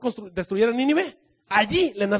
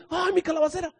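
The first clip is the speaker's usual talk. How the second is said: high whiny wail over the gourd; preacher mocking Jonah's whining, high; mock lament; 10 semitones higher than usual. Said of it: "ya estabas tú".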